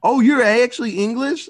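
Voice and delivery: high, loud imitated astonishment; mock surprise. Imitating a viewer learning an actor is English, mock surprise.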